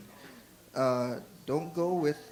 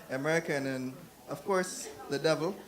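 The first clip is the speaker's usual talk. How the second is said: ordinary talk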